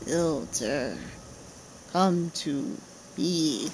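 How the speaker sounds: low, distant ghostly call; a low disembodied voice calling the builder; spooky voice. Acted. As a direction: summon them like a spirit from underground. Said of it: "what the heck was that spooky voice".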